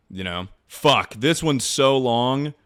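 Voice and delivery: ordinary delivery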